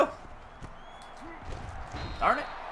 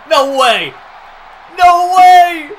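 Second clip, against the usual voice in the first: loud, high disbelieving yells; stream-hype shouting; ecstatic shouting; 14 semitones higher, 14 dB louder, slower than usual. Real, not acted. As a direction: scream with pure real joy. 99-yard touchdown just happened.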